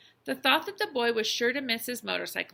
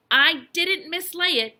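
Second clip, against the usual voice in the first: loud, high, drawn-out protest; indignant boy standing his ground; insistent; 7 semitones higher, 10 dB louder, slower than usual. A narrator's reading. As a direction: deny it firmly, stretching the words. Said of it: "insisted the boy".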